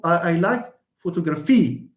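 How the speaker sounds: photography with the beat on final PHY; wrong final stress. Shown as a demonstration. Teacher mimics a learner's error, stressing the last syllable. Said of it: "No, it's photography".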